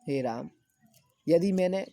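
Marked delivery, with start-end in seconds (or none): none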